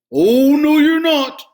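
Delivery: very loud roaring troll. A narrator's reading. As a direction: bellow a threat. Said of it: "roared the troll".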